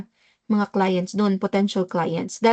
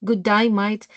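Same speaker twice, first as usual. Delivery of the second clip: "Good day, mate" as an Aussie "good die, mite"; mock-Australian greeting; Australian accent; slower than usual. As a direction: mimic an Australian, "day" and "mate" with "eye" vowels. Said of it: "Good day, mate" lang pala yun".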